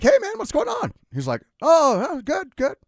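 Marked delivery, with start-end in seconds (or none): 0.0-0.9 cheery greeting. Retold or imitated. Grandson voiced greeting. 1.6-2.8 chipper grandpa. Retold or imitated. Grandpa voiced next day.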